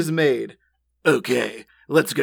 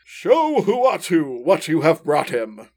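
deep commanding voice. A narrator's reading. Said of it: "the deep voice orders".

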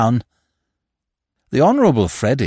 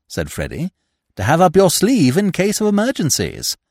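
put-on higher, eager young gentleman voice; 8 semitones higher than usual. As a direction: pitch the scheme like a keen young toff. Freddy voiced throughout scheme.

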